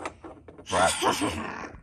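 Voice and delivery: low, husky villain chuckle; villain laugh. Pretend. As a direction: laugh like a play-acted baddie. Green Goblin laughs after his threat.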